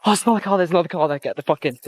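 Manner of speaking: high, alarmed, urgent outburst; real panic, pitched high and falling; panicked. Real, not acted. Spotting another car while fleeing, panicked.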